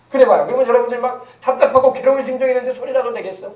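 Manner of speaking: normal talking voice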